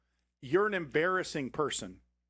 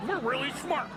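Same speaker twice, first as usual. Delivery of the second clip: mocking European voice; 9 semitones higher, slower than usual.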